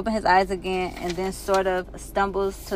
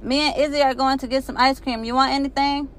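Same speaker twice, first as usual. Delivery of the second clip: high, called-out shout upstairs; calling out; 6 semitones higher than usual. Acted. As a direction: bellow it up the stairs. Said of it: "he bellows out".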